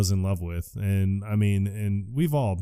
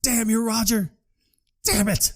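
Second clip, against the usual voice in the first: high-pitched mock anguish; hammed-up high grief cry; 10 semitones higher than usual. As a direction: wail it like an overwrought mourner. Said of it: "Chad's crying".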